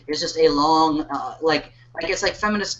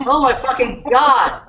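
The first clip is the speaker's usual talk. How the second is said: laughing exclamation, slow; 4 semitones higher than usual. Real, not acted. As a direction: burst out laughing. Laughing at siren joke.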